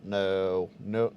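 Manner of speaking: ordinary delivery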